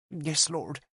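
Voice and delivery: put-on high, soft child's voice; a timid small answer; nervous young girl. Child answering the bishop, nervous and soft.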